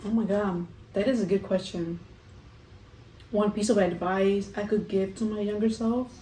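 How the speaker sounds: ordinary talk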